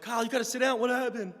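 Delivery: put-on high, worried girl's voice; lightly voiced alarmed friend. Girl sees his bloody legs.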